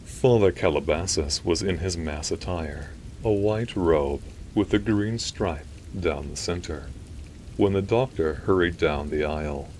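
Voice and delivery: usual speaking voice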